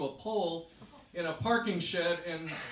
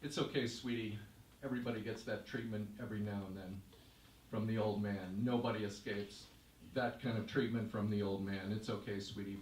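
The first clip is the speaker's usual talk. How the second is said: softer, lower, kindly comfort; consoling; 6 semitones lower, 5 dB quieter than usual. Retold or imitated. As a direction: gently soothe a tearful girl. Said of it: "the man says to you".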